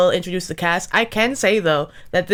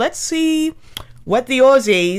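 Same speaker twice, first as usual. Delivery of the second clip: Australian accent attempt; 6 semitones higher, much slower than usual. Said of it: "it might slip into British".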